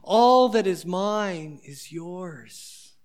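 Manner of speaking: put-on father gently soothing the older son; tender father. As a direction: hold the hurt son close with words. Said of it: "He says".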